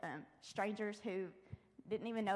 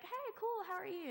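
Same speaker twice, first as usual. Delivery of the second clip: a much higher, sing-song mock reply; imitating a friendly stranger; 11 semitones higher than usual. Strangers answering her greeting, in a high mock voice. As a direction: mimic a breezy passer-by. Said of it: "some people would be like".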